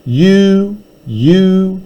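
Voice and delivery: each You hit hard and set apart; gloating You, You; emphatic yous. Shown as a demonstration. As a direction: stab every You. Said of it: "nice little bit of yous there".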